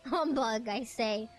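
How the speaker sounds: loud, high, hammed-up British outburst; mock British accent. Streamer mocking how this British guy talks, exaggerated British accent. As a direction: blow it up into a blustering British caricature. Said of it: "what British people do?".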